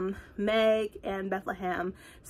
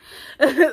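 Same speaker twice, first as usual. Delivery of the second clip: loud laugh; 6 semitones higher, 10 dB louder than usual. Real, not acted. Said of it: "laughing at own remark".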